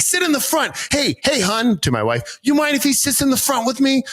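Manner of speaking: thick Boston "hey hun"; Boston accent. Retold or imitated. Driver inviting me to the front, Boston accent. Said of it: "switch accents quick".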